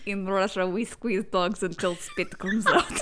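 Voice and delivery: slow, soft mock Russian accent. Joking about Pavlov's dogs in a mock Russian accent. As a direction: joke it out like a stage Russian. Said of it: "I wish I could do a Russian accent".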